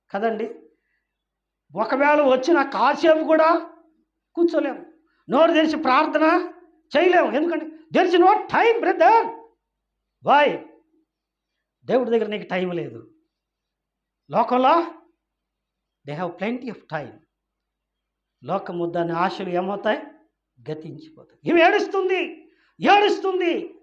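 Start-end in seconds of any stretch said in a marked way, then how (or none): 1.8-10.6 climbing higher and louder, preacher's cry; preacher rising to a loud rebuke; fiery preaching. Acted. Preacher building to full force. 21.5-23.8 climbing higher and louder, preacher's cry; preacher rising to a loud rebuke; fiery preaching. Acted. Preacher's emphatic exclamation.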